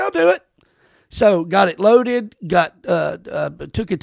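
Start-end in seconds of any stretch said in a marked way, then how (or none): 0.0-0.4 high, bright volunteering; high eager offer. Retold or imitated. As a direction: pitch it up, eager to help. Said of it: "So I was like".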